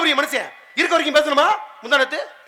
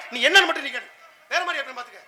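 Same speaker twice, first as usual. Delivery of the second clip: ordinary delivery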